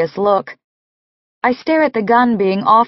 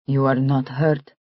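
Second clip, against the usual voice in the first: put-on Russian accent; 7 semitones lower, 5 dB quieter than usual. Nick speaks in Russian accent.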